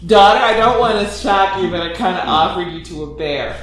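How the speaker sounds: hammed-up mother owning up; mock sheepish mother. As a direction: play the guilty mother, cracking up. Voicing the mother to daughter.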